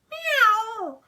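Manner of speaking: high, loud mock meow; a loud startled cat cry; hammed-up cat call; cat hissing. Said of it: "who hissed".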